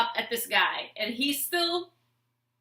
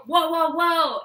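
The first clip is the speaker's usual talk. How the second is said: mock alarm; 6 semitones higher, 8 dB louder, slower than usual. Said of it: "I gave her".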